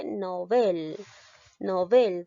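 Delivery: slow "novel" with the weight on BEL; teacher's careful demo of "novel", stressed on its final syllable; stress on the last syllable. Shown as a demonstration. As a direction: say novel as no-BEL, stretching the end. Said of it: "te vas a basar en mi pronunciación, que dice".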